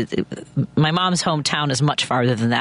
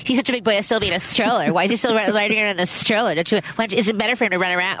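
nosy mom; 5 semitones higher, faster than usual. Retold or imitated. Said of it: "ask me questions like".

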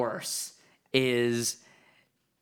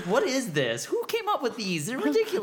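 much higher, incredulous mock outrage; 12 semitones higher than usual. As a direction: squeal your disbelief at the rumors. Mock outrage at fan rumors.

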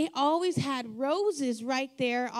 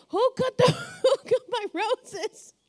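a higher, louder, half-laughing mock scold; mock upset; 8 semitones higher, 6 dB louder than usual. Dad scolding grandkids over cut roses, in a laughing mock scold. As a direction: playfully imitate dad demanding who cut his roses. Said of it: "he would just get so upset".

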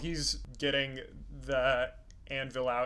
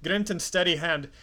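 loud, hammed-up Scotch-Irish greeting; Scotch-Irish accent; 3 semitones higher, 6 dB louder than usual. Dwarf surprised to meet an old friend, booming Scotch-Irish accent. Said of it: "my terrible Scotch-Irish accent".

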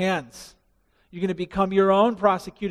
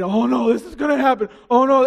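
mock panic; 6 semitones higher, 7 dB louder than usual. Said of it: "I get all worked up and afraid".

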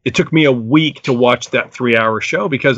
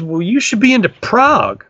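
higher friend voice giving the verdict; imitating his friend Dan; 9 semitones higher than usual. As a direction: hand down the advice like an expert. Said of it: "He goes".

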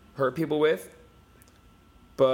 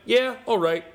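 high, loud casual "yeah, all right"; lightly voiced shrugging Aussie crowd; mock Aussie agreement; 10 semitones higher, 5 dB louder than usual. Retelling Jim Jeffries' bit, the Aussies shrug yes, high and loud. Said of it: "I'm not gonna do an Australian accent".

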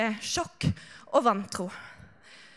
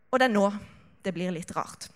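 ordinary delivery

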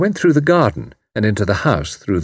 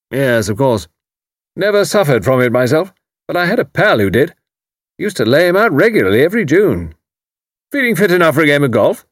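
put-on bluff old commander; 6 semitones higher than usual. A narrator's reading. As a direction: boom it out like a blustering retired officer. Haydock voiced.

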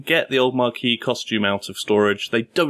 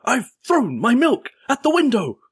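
very high-pitched, hammed-up dismay; overwrought infomercial acting; 12 semitones higher than usual. Host playing infomercial game with friends, wildly overwrought. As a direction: overact like a helpless infomercial victim.

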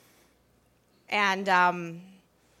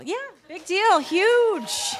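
much higher, louder mock gush over the big deal; mock excited boast; 11 semitones higher, 5 dB louder, much slower than usual. Pastry chef to the audience, playfully boasting, high and loud. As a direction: ham up how huge the award is.

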